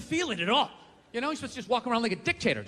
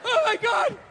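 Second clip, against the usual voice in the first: mock dieter; 8 semitones higher than usual. Acted dieter dialogue.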